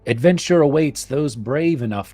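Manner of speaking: rally the listeners to adventure; epic story narration. Acted. Dramatic call to adventure.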